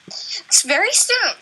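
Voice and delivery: choppy, breaking-up call audio; corrupted glitchy audio. Real, not acted. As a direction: voice cutting out over the line. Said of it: "it was before when you were talking about your braces".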